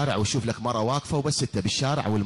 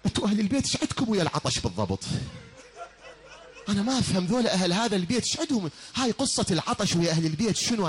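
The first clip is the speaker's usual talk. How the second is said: laughing; 8 semitones higher, faster than usual. Real, not acted. Preacher laughs at own question.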